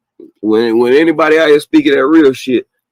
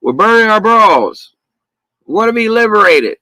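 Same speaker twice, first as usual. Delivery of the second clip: lampoon the protesters, pitched way up; hammed-up high mock chant; mock women protesters; 8 semitones higher than usual. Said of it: "a bunch of females getting behind something".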